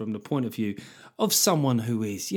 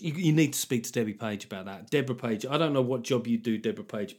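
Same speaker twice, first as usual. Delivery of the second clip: ordinary delivery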